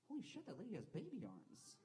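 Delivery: high, hushed-down astonishment; shocked inner thought. Acted. Passenger silently noticing a lady on the train, astonished. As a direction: think it in a high, amazed voice. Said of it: "not a big enough asshole to say it out loud".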